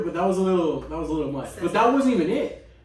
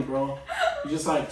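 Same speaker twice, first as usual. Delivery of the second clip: ordinary talk, nothing marked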